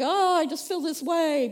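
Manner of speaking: exasperated imitation; mock complaint. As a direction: mimic someone helplessly swept along by feelings. Mimicking people overwhelmed by feelings.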